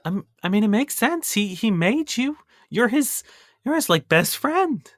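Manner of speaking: high, gentle and kind; tender cook. Acted. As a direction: soothe the boy warmly. Isabella comforting Cody.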